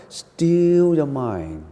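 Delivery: long, lingering STILL, easing into "your mind"; slow, drawn out. Shown as a demonstration. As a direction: stretch STILL out very slowly. Models stilling the mind slowly.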